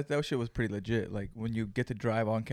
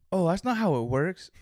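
higher, louder mock protest; mock customer outburst, pitched up; retold objection; 7 semitones higher, 8 dB louder than usual. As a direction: protest loudly as the put-out customer.

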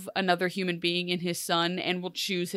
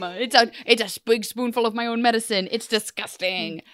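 mock lament; 3 semitones higher than usual. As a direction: wail in mock disgust at your own medicine.

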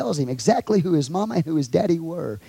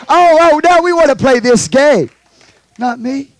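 sharp shout, much higher and louder; 11 semitones higher, 10 dB louder than usual. Real, not acted. Preacher shouting at the congregation over games. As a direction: call them out loud and high.

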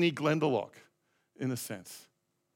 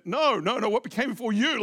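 mock-impatient interruption, higher and louder; insistent correction; 6 semitones higher, 5 dB louder than usual. Pastor voicing the woman correcting his answer, insistent. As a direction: cut in to correct a wrong guess.

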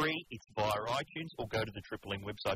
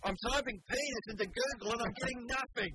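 high-pitched mock complaint; imitating the producer; 11 semitones higher than usual. Host imitating producer searching at the computer, high mock frustration. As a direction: put on a much higher voice for the producer. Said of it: "Our producer Nikki is over at the computer going".

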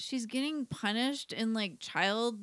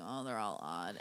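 much lower, much softer deadpan; low deadpan mutter; 13 semitones lower, 10 dB quieter than usual. Retold or imitated. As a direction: mumble it low to yourself. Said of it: "I'm like".

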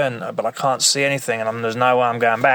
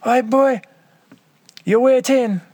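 put-on Jamaican accent; 14 semitones higher than usual. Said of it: "this fucking crazy Jamaican West Indian accent".

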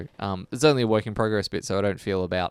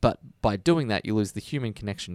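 ordinary talk

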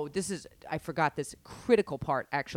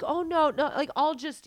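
imitating the woman; 9 semitones higher than usual. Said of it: "she's like".

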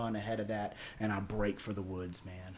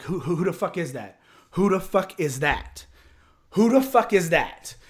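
rising panicked yell, louder each time; panicked shout; 7 semitones higher, 11 dB louder than usual. Retold or imitated. Man spotting headlights in the woods, panicked yelling. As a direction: shout it more frantic each repeat. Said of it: "I'm like".

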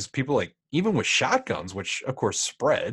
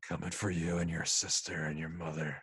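low menacing villain; 8 semitones lower, 6 dB quieter, faster than usual. Retold or imitated. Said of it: "He's like".

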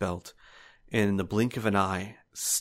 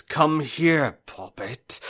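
a louder, lightly voiced menacing call; taunting villain call; 7 semitones higher, 4 dB louder, slower than usual. Old swordsman calls after the hiding sister. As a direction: lure her out mockingly. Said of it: "smashes glassware and says".

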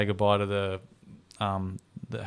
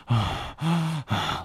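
heavy panicky breaths, acted out; imitated hyperventilation; hyperventilating; 5 semitones higher than usual. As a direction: huff in and out as if barely holding it together. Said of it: "like, hyperventilating".

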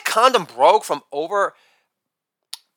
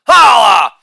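loud exclaimed tag; big showy holler; shouted catchphrase; 4 semitones higher, 8 dB louder than usual. Acted. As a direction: belt it out as a punchline button. Shouted catchphrase after each bit.

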